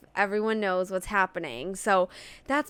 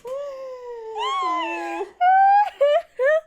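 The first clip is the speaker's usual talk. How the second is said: loud laughter; 5 dB louder than usual. Real, not acted. Friends giddy after finishing book.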